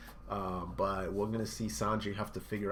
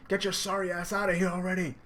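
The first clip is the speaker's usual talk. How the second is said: higher mock yell; angry shout; 9 semitones higher, 5 dB louder than usual. Sanji yelling at Luffy to leave. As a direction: shove him off with the words. Voicing Sanji mid-fight.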